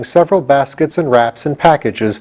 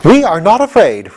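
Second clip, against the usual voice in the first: high, loud firm retort; determined; 8 semitones higher, 4 dB louder than usual. Acted. General Ginger answers the gatekeeper, determined and high. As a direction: stand firm. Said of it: "looked so determined".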